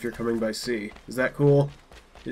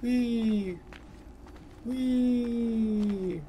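high-pitched gleeful whee; 10 semitones higher, 5 dB quieter, much slower than usual. Pretend. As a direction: squeal it like a kid on a slide. Fast travel glide.

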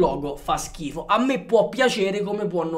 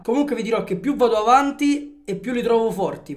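ordinary delivery

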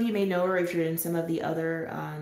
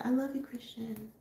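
tender; 6 semitones higher, 6 dB quieter than usual. Retold or imitated. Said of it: "So I said".

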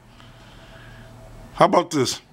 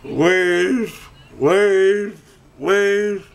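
calling out; 7 semitones higher, much slower than usual. Real, not acted. Calling to viewers to wave.

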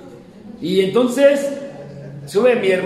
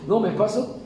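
normal talking voice

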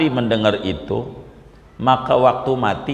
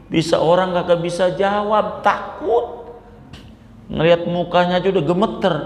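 higher, rising scold that climbs to a cry of fright; scolding Umar; 6 semitones higher than usual. Acted. Umar scolding the grave angels, higher and building. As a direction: tell off the angels, getting more worked up.

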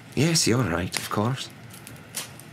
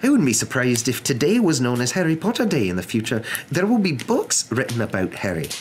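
high, emphatic, exasperated professor; incredulous protest; 11 semitones higher, 3 dB louder than usual. A narrator's reading. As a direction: argue in disbelief, voice pitched high. McGonagall disbelieving, protesting to Dumbledore.

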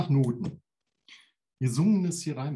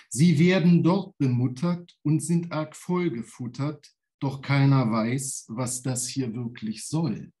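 lively declaimed verse; poem recital; 4 dB louder than usual. Acted. Poet reading his song lyric aloud to the audience. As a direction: read the lyric with bounce.

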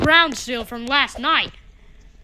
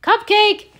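high loud call of the name; 8 semitones higher, 5 dB louder than usual. Real, not acted. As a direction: yell for the missing dog. Searching for lost dog.

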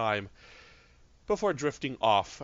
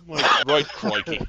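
attempted Australian; 4 semitones higher, 9 dB louder, slower than usual. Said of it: "I could usually do Australian so well".

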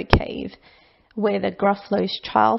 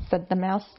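plain reading voice

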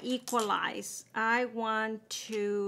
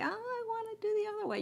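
quick, much higher mock-naive voice; mocking own whim; 11 semitones higher, much faster than usual. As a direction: toss it off like a silly self-parody, fast and high. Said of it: "I say".

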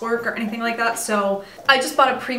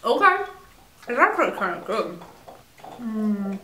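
chewing while talking; muffled by food; mouth full. Real, not acted. Seen 1: Lips pressed and moving after bites; egg in hand throughout.

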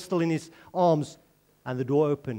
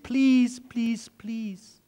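high plea fading to a hush; pleading; 5 semitones higher, much slower than usual. Real, not acted. Preacher begs the congregation to obey, pleading and fading away.

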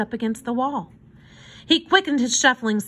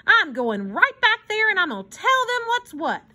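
high, blustering determination; defiant resolve; 11 semitones higher than usual. A narrator's reading. Hobo vows to tell them what's what, defiantly. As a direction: puff yourself up and declare it.

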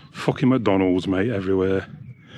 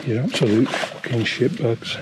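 real disgust; 2 semitones higher than usual. Real, not acted. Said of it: "I'm quite mad at the moment".

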